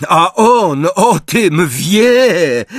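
high, drawn-out gloating shout; slow, high-pitched taunt; manic. A narrator's reading. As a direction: crow it wildly. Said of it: "wybuchając szaleńczym śmiechem".

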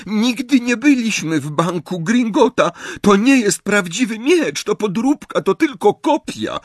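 high-pitched tearful pleading; Hermione. A narrator's reading. Hermione denies entering the bank, sobbing plea. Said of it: "załkała Hermiona".

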